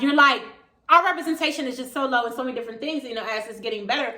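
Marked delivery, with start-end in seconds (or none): none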